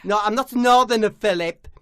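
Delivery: high, loud, quick denial; high loud fast retort; fast indignant retort. Acted. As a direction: snap back indignantly. Debbie indignant.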